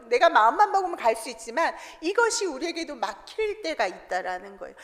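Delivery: ordinary delivery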